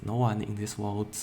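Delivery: ordinary delivery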